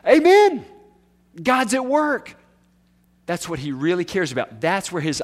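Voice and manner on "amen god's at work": joyful amen. Retold or imitated. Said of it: "he says".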